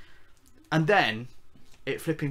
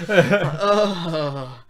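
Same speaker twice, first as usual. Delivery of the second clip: laughing; 3 semitones higher, 6 dB louder than usual. Real, not acted. Laughing at the cringey line.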